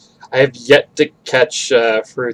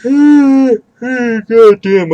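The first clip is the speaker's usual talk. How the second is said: yawning; 12 semitones higher, 5 dB louder than usual. Real, not acted. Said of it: "I'm always yawning".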